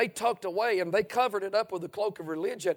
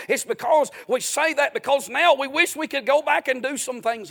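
booming motivational speech; 4 semitones higher, 8 dB louder than usual. Real, not acted. Preacher grows louder to the congregation.

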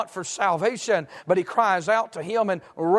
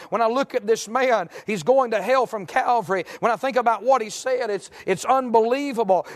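raised, driving sermon warning; fervent preaching; 5 semitones higher than usual. Real, not acted. Preacher warning the lost who take life casually, fervent. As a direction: press the warning home without letting up.

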